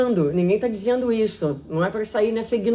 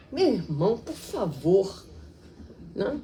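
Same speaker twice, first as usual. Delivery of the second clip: softer, falsely gentle plea; syrupy fake sweetness; 6 dB quieter than usual. Speaker mocks a hypocrite's sugary plea at the spiritist center. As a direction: sweeten it like a hypocrite hiding anger. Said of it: "a gente vai botando uma entonação".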